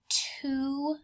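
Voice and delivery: breathy whisper to build suspense; whispered number. Acted. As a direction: lean in and whisper it. Teacher stressing number for kids.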